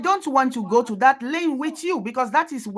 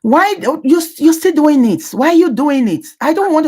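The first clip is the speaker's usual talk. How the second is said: louder and higher, fed-up protest; exasperated outburst; 5 semitones higher, 10 dB louder than usual. Real, not acted. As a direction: snap back, annoyed they keep pushing. Exasperated at repeated Baba questions.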